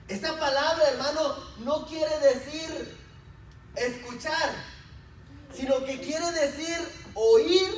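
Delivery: emphatic pulpit delivery; forceful preaching. Real, not acted. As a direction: drive each point home to the congregation.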